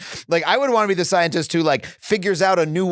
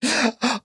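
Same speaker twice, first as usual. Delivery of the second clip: delighted gasp; 9 semitones higher than usual. Real, not acted. Hearing rats play hide and seek.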